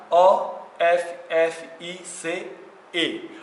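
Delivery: O F F I C E spelt out letter by letter, slowly; slow spelling out. Shown as a demonstration. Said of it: "eu vou escrever pra você aqui office".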